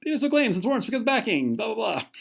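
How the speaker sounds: high, fast excited rattle; quick, high and giddy; excited rush. Real, not acted. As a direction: rattle off the formula in a burst of excitement. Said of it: "Sorry, I got really excited".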